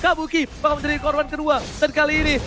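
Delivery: fast, rising hype play-by-play; fighting game announcer. Acted. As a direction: call the teamfight like it's the finals. Hyped play-by-play of a teamfight. (komentator esports yang heboh)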